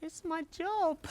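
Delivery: high, soft little sun voice; sun's reply. Acted. Sun answering the farmer in a soft high voice. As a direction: answer lightly, a bit hurt.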